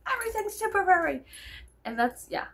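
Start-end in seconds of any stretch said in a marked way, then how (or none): 0.1-1.2 much higher, faster mock of the dad's line; a lightly voiced, higher Moonstruck dad; imitating the dad. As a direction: toss it off like the old dad in Moonstruck. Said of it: "And he's like".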